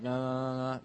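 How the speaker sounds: droning, run-together mock recital; mock unenthusiastic. Shown as a demonstration. Preacher showing the congregation how not to pray, mock listless. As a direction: drone it listlessly as the example of what to avoid. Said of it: "So not that, like".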